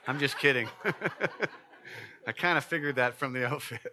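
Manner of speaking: joking through laughter; laughing. Real, not acted. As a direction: laugh as you tease. Joking about the baby.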